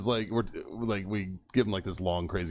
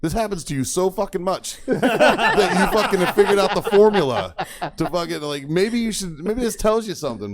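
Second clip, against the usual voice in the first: higher, louder, laughing through the jab; laughing tease; 10 semitones higher, 7 dB louder than usual. Retold or imitated. Mocking the lightweight friend, laughing. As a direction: rib him while cracking up. Teasing buddy while laughing.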